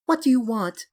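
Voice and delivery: high, breathless gasp in fear. A narrator's reading. Dorian gasping at the gunman in fear. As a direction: gasp it out, terrified. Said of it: "he gasped".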